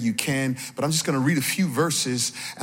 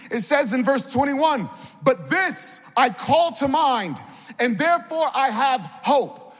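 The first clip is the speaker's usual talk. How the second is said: high, rousing sermon delivery; impassioned preacher's call; preaching; 10 semitones higher than usual. Real, not acted. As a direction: exhort the congregation in rhythmic, falling phrases. Preaches with raised voice.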